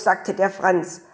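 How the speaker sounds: plain reading voice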